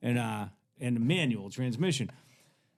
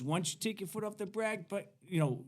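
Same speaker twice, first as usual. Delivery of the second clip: higher, lightly voiced instruction; imitated driving coach; 9 semitones higher than usual. Hippie to teen stopped on steep hill, imitated coaching. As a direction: pitch it up and urge them on.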